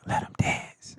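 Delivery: whispered, breathy background call; homegirl in the back. Retold or imitated. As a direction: hush it like a voice from the back of the track. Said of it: "I got my homegirl in the back, like".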